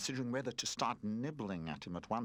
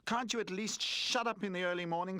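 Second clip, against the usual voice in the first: raised, exasperated complaint; irritated emphatic grumble; 10 semitones higher, 4 dB louder than usual. A narrator's reading. Roller scolding the crying new toy, irritated. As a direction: make the noisy baby shut up. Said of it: "said Roller emphatically".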